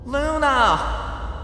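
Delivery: high, loud joyful call; high loud happy shout of a name; relieved cry. A narrator's reading. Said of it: "Twilight cried, relieved".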